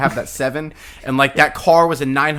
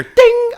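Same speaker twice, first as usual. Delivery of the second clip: very high, loud imitated metallic ding; ringing high "ding"; bat-hit ding; 7 dB louder than usual. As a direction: mimic a ball pinging off a bat. Imitates bat hitting ball.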